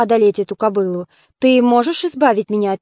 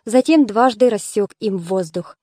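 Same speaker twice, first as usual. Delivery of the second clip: plain reading voice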